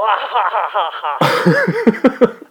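hearty mocking laugh. Caller laughing at insult.

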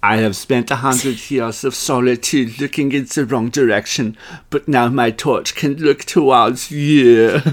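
slow, badly faked French accent; a lovesick statue in clumsy French accent. Statue of Liberty pining for her lover, in a clumsy French accent. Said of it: "'cause I can't do a French accent".

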